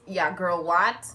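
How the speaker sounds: ordinary delivery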